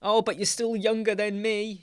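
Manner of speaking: mock patronizing voice. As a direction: tease it back like an annoying elder. Mocking older person's comment.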